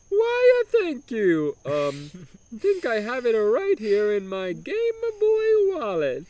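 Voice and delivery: high, slow mock Italian order; Italian accent order. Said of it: "obnoxious broken Italian accent".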